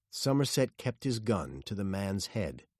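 ordinary delivery, nothing marked

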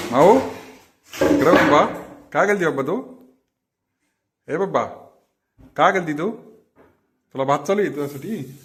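put-on high, coaxing baby talk to a cat; pet talk. Owner coaxing the cat Mau, high baby talk.

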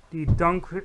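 ordinary delivery

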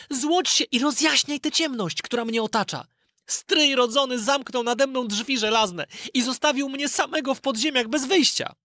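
put-on high boyish voice calling the spirit; a boy pleading with a magic spirit; Aladyn summoning. Aladyn calls the golden spirit to shine, high boyish voice. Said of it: "zawołał Aladyn".

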